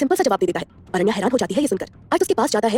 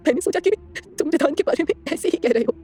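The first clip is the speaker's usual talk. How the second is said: sobbing; 3 semitones higher, slower than usual. A narrator's reading. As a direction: apologise through sobs. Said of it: "सुबकते हुए बोलती है".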